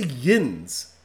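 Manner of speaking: "yins" with a nasal vowel, mocking; Pittsburgh "yins", mocked. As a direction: sneer out "yins" like a Yinzer. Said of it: "They say".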